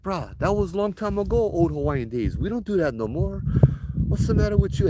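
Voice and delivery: high-pitched, incredulous telling-off; indignant scolding. Retold or imitated. Retold scolding of the spirit.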